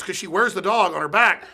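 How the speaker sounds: ordinary delivery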